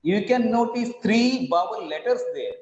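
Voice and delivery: normal talking voice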